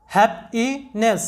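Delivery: Happiness drawn out very slowly, each syllable clear; teacher slowly models happiness; slow demo pronunciation. Shown as a demonstration. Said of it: "happiness, এরকম হবে".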